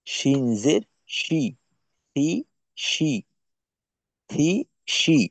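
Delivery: শি with a hushed sh, সি with a sharp s; shin and sin contrast. Shown as a demonstration. Teacher contrasting two Arabic letters, careful slow recitation. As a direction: contrast শি and সি slowly and clearly. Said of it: "এখানেও পার্থক্যটা হয় উচ্চারণের জায়গা".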